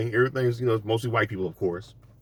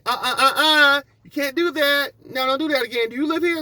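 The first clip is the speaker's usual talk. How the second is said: high, shrill-pitched scolding Karen imitation, laughing at first; mock an outraged neighbor scolding. Comedian mimics Karen scolding him at the gate, high mock voice. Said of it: "she gonna say".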